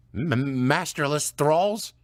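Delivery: stammering. Acted. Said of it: "stammered Alderman Sheedy".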